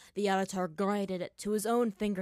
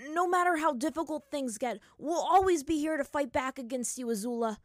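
put-on defiant young hero; 7 semitones higher, 7 dB louder than usual. Hero defies villain in battle.

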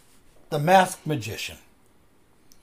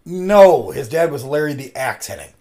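normal talking voice

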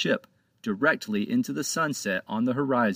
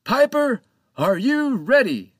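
hailing the parrot; 12 semitones higher, 7 dB louder than usual. Acted. Captain rallying the crew.

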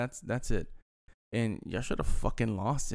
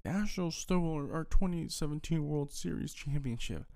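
higher, whiny mock complaint; mock whining fan; 5 semitones higher than usual. Mocking Dodgers fans who cry about the stolen title, whiny. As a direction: whine it like a sore loser. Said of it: "y'all wanna cry and bitch and complain".

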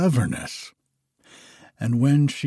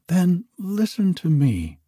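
prince crying out; 8 semitones higher than usual. A narrator's reading. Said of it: "cried the prince".

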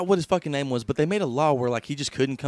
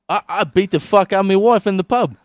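mock loud confession; 6 semitones higher, 8 dB louder than usual. Acted bloody suspect.

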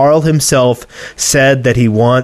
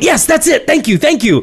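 relieved excited wife; 14 semitones higher than usual. Retold or imitated. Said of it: "she went".

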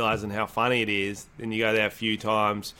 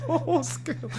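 high, squeaky laugh through the words; helpless high-pitched laughter while talking; 4 dB quieter than usual. Real, not acted. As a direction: repeat it while cracking up. Cracks up repeating the joke.

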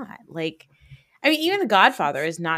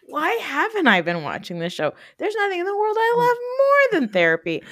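overjoyed and a bit hammed-up; gushing excitement; 13 semitones higher than usual. Real, not acted. Telling her friend how much she loves a show about therapy, gushing. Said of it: "I'm like".

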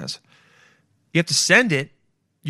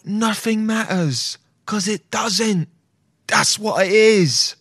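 dazed K-hole voice; 6 semitones higher, much slower than usual. Pretend. Imagines being in a K-hole.

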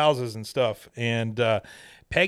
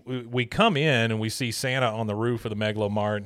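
normal talking voice

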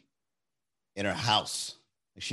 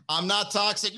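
mock protest; 10 semitones higher than usual. Acted exchange with goading date.

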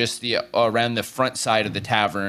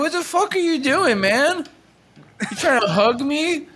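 put-on drunk man yelling; 12 semitones higher than usual. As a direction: scold him like an offended drunk. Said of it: "He's like".

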